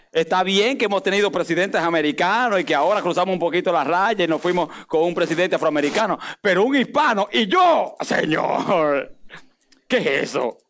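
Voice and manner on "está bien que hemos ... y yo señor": normal talking voice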